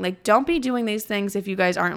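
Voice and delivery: normal talking voice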